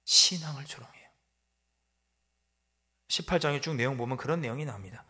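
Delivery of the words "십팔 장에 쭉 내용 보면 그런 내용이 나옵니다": normal talking voice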